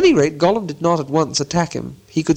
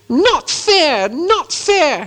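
high, upset creature whine; Gollum; 14 semitones higher, 4 dB louder, much slower than usual. A narrator's reading. Gollum, frightfully upset by the riddle, indignant. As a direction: protest loudly in a high creature voice. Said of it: "he hissed".

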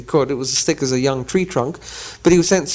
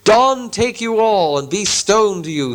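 slow, slightly higher troll-like curse; triumphant curse; 6 semitones higher, slower than usual. Acted. Gandalf cursing the trolls at dawn, slow troll-like voice. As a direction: savour the trap closing. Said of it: "said a voice".

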